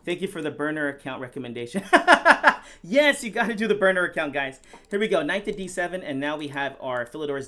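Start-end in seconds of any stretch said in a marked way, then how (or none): 1.9-2.8 sudden loud high laugh; high burst of laughter. Real, not acted. Laughs at chat joke.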